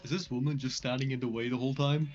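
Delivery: usual speaking voice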